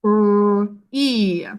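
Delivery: R, E said as separate sounds; sounding out R, E. Shown as a demonstration. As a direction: break R and E apart sound by sound. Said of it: "what sounds do you hear".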